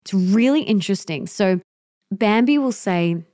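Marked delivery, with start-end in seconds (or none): none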